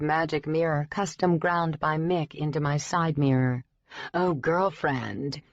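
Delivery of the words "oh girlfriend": slow, sassy drag queen drawl. Acted. Magic mirror greeting its owner in a drag queen drawl. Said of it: "in its drag queen drawl".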